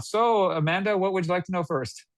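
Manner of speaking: lifted, friendly "what would you like to know first". Shown as a demonstration. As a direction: ask it lightly and upward. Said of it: "You know, you can write this down".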